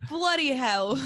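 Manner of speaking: mock British with a laugh in it; British accent. Guest joining host's bit, playfully mock British. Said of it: "That's my British accent".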